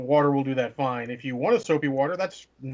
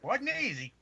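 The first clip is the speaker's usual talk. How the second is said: put-on high creaky old-timer voice; old man voice; 10 semitones higher, 4 dB quieter than usual. Shown as a demonstration. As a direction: complain like a cranky grandpa. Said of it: "old man voice".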